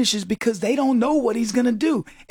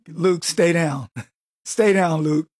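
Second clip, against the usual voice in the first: film tough guy; 6 semitones lower, slower than usual. Retold or imitated. Acts film fighter telling Luke off.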